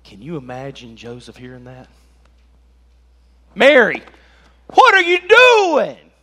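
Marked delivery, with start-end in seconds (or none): 3.5-6.1 high, loud, drawn-out Italian-style yell; mock Italian outburst. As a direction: yell it like an exasperated Italian husband, hammed-up. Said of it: "Like they turned Italian all of a sudden".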